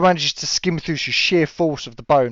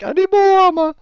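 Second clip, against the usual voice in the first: much higher, mock terrified whine; scared whimper; 4 dB louder than usual. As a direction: whine like a frightened coward. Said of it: "so you're like".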